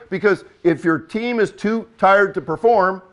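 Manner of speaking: usual speaking voice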